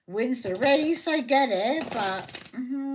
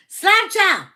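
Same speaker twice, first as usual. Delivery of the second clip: loud, high-pitched shout of Sláinte; hearty toast; 8 semitones higher, 10 dB louder than usual. Real, not acted. Toasts again after explaining pronunciation.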